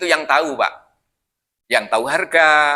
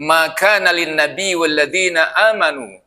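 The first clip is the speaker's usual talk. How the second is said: Quran recitation. Acted. Preacher to congregation, reciting the verse in a chant.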